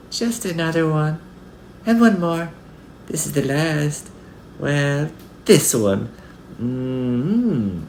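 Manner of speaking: put-on high little-girl voice, savouring each bite; high, gleeful child tasting treats; delighted little girl. A narrator's reading. Little girl greedily eats strawberries.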